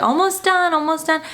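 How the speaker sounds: high, sing-song mock reassurance; hammed-up soothing dentist. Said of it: "the dentist is like".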